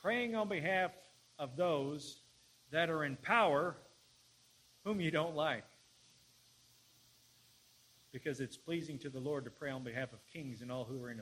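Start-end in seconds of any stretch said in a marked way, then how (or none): 0.0-5.6 loud, raised phrases with weighty pauses; emphatic preaching. Real, not acted. Preacher urging prayer for those in power, emphatic preaching. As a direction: drive each phrase home from the pulpit. Preacher drives the point home.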